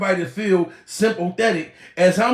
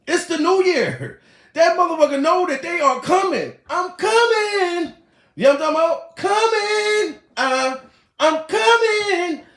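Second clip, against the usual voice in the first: mock high voice; 9 semitones higher than usual. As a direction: stretch coming up high and teasing.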